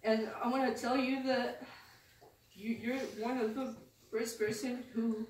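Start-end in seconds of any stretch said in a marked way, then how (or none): none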